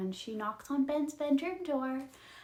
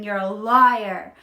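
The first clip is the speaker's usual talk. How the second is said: loud, slow mock yell; retold scream; 11 dB louder, slower than usual. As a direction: yell the accusation, stretching it out. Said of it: "he is screaming".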